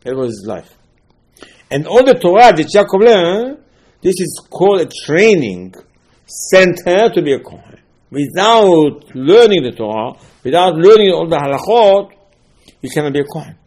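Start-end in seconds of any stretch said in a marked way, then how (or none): 1.7-12.1 louder preaching. Real, not acted. Preacher builds to a climax.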